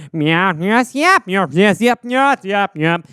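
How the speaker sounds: clipped alien yeps; alien chirp. Acted. Alien chirping yes. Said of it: "they're like".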